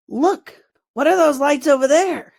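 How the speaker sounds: a goblin hollering at his mate; goblin shout. A narrator's reading. Goblin spots the fireflies and shouts to his partner, loud and high.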